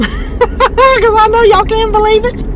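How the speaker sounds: real high-pitched laughing fit through the words. Real, not acted. Vlogger cracks up at her own sarcasm, laughing. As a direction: crack up while talking, high and drawn out.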